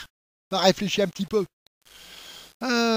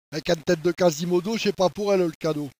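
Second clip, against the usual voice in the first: ordinary talk, nothing marked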